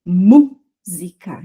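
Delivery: "música" very slow, MÚ loud and long, then si-ca; stressed syllable stretched. Shown as a demonstration. Teacher giving an example, MÚ of "música" loudly stretched. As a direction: hold a loud MÚ in "música", then place the rest. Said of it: "é a sílaba que se estende".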